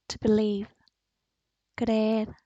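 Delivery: usual speaking voice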